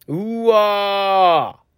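a very long, slow, stretched-out "うわー"; drawn-out wow. Real, not acted. Host reacting to listener praise with a long, stunned wow. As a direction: let the wow hang, stunned with joy.

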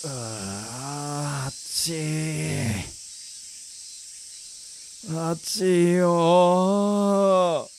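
slow, drawn-out moan about heat; sweltering groan. Acted. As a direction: drag every vowel, wilting in the heat. Acted man suffering in the heat. (暑さにうだる声)

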